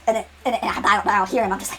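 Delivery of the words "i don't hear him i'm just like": normal talking voice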